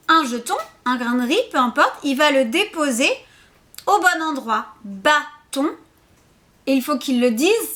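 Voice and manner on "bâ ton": "bâton" en deux syllabes détachées, bâ puis ton; mot décomposé. Shown as a demonstration. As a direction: marque une pause dans "bâton". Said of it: "Vous pouvez décomposer". (« bâton » scandé en deux temps)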